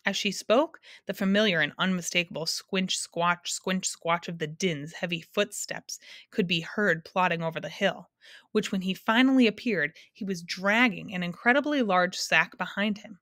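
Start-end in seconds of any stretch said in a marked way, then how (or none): none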